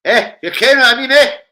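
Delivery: loud high hype; hyped shout. Real, not acted. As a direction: hype the viewers up. Hyped at viewers.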